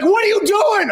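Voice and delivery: very high comic screech; mock outraged lady. Lady to man closing door, mock high outrage. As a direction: shoot the pitch way up in disbelief. Abandoned lady shrieks at him.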